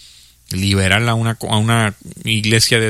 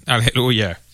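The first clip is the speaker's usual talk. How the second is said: ordinary talk, nothing marked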